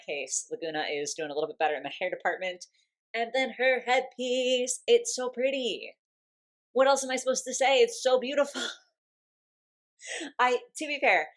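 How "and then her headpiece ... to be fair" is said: higher, louder, gushing over the headpiece; bubbling over with delight, voice up high; overexcited gushing. Real, not acted. Doll reviewer gushing over the headpiece, overexcited and loud. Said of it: "I'm getting overexcited".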